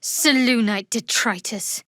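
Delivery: ordinary delivery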